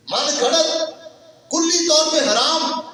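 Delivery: sudden high, forceful verdict; emphatic denunciation. Real, not acted. Preacher ruling an invented prayer forbidden, emphatic denunciation. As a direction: declare it firmly, pitched high.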